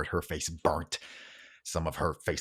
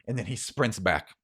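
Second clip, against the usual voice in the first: ordinary delivery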